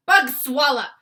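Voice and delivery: very loud, high boom; booming giant. Acted. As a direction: blast the curse at him. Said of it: "he boomed".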